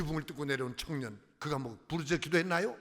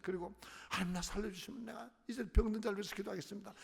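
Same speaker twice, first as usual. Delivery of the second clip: quiet inner prayer; 5 semitones higher, 6 dB quieter than usual. Retold or imitated. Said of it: "마음속에서 그냥 잠잠해서".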